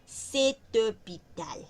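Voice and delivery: "cetopital" drawn out syllable by syllable; slow careful demo. Shown as a demonstration. Teacher slowly sounds out "cetopital" for the class. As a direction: spell out "cetopital" slowly for students. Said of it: "Pero acá".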